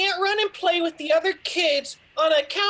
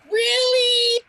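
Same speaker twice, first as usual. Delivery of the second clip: surprised gasp; 7 semitones higher, 4 dB louder than usual. Acted. Boy hears Santa's promise.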